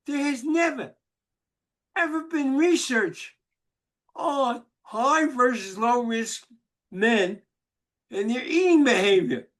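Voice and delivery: high, emphatic protest; indignant. Retold or imitated. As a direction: insist like an offended researcher. Retold indignant scientist protest.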